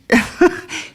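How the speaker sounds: high, airy real laugh; light high chuckle; amused laugh. Real, not acted. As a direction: laugh briefly at your own amazement. Laughs at her own astonishment.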